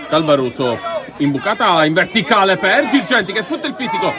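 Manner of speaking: normal talking voice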